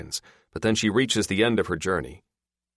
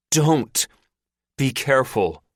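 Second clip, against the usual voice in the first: warning; 8 semitones higher, 6 dB louder than usual. Acted. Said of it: "Lou warns".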